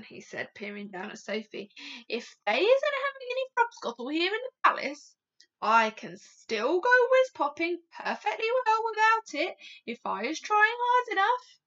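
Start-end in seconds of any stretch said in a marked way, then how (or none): 2.1-11.6 high, loud, boastful giant voice; sly giant. A narrator's reading. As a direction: announce mischief in a raised voice. Sly giant teases Sophie.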